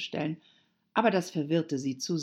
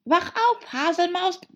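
high, loud wake-up call; piercingly high shout; 7 dB louder than usual. A narrator's reading. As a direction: yell to rouse a sleeper. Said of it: "riefen beide".

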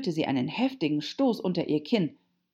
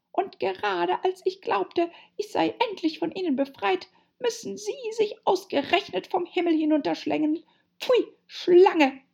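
very high pigeon tirade, loud burst at the end; voice rising to screaming; 13 semitones higher, 4 dB louder than usual. A narrator's reading. Pigeon raging at Alice as a snake, rising to screech. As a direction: build to a shrieked insult. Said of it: "ihre Stimme bis zum Schreien steigerte".

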